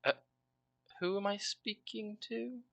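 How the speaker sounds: puzzled, hesitant lady asking who's there; confused woman. Acted. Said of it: "you hear a confused feminine voice".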